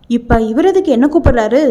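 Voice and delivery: plain reading voice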